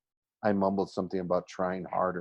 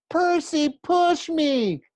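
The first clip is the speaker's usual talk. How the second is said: shrill scream, far higher and louder; 7 dB louder, much slower than usual. Acted. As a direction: shriek the accusation from the fountain.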